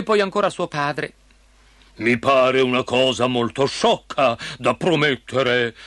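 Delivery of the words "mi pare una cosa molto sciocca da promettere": stern, slow, weighty king's voice; thundering king. A narrator's reading. King scolding his daughter's promise, thundering slowly. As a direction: pronounce it as a disapproving royal father. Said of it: "tuonò il re".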